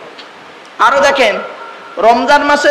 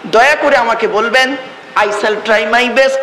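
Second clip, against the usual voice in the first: ordinary talk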